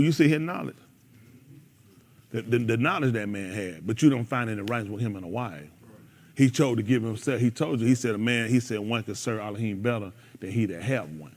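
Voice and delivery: low, soft sincere teaching; subdued, low-pitched lecture to the room; earnest quiet counsel. Real, not acted. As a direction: drop the jokes and advise them seriously. Turns to serious counsel.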